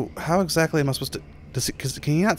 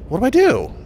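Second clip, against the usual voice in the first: higher, louder frightened plea; panicked; 10 semitones higher, 6 dB louder than usual. Real, not acted. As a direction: cry out in panic. Terrified plea.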